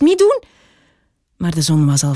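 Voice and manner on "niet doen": much higher, loud cry of alarm; calling out. A narrator's reading. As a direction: shout after the escaping sun. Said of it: "riep de wesp".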